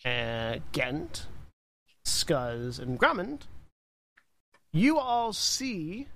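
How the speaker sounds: ordinary delivery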